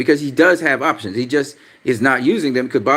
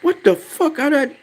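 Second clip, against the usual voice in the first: pitched way up, stunned and exasperated at the screen; panicked disbelief at a misclick; real exasperation. Real, not acted. Streamer to chat after deleting a message, exasperated high whine.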